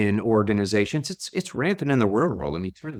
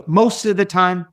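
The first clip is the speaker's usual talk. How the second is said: imitated Southern accent, higher and louder; a lively Southern-preacher lift; 8 semitones higher, 6 dB louder than usual. Zig Ziglar stresses his point, mild Southern accent. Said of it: "I'll try to do his accent".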